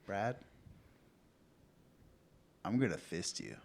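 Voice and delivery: low, soft and measured, with pauses; eerily calm, quiet comeback; calm threat. Acted. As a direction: say the threat with mock serenity. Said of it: "just calmly say".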